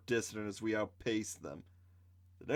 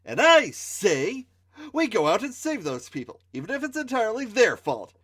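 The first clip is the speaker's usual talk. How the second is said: put-on bossy girl; 9 semitones higher, 6 dB louder than usual. Kenda insisting to the group she leads, bossy high voice. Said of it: "Kenda said".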